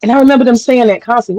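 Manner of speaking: normal talking voice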